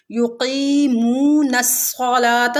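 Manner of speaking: युकीमून slow, की and मू both drawn out; stretched की and मू. Shown as a demonstration. Teacher demonstrating two madd letters in युकीमून. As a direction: recite युकीमून अस्सलात with long vowels. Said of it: "थोड़ा सा खींच के पढ़ेंगे".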